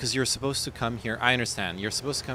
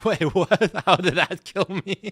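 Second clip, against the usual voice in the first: loud laughing disbelief, pitched high; raging gamer; 12 semitones higher, 10 dB louder, slower than usual. Real, not acted.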